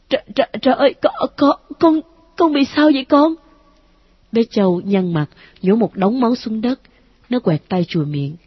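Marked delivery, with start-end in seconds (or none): 0.1-3.3 stumbling over con, frightened, pitch rising; a mother horrified at her child; stammering in fright. A narrator's reading. Mother sees son chew his finger, stammering in fear. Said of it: "Cô lắp bắp".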